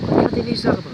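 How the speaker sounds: voix forte et aiguë contre le vent; crier par-dessus le vent. Real, not acted. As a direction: lancer ça fort pour couvrir les rafales. Filming outside in strong wind. (crier par-dessus le vent)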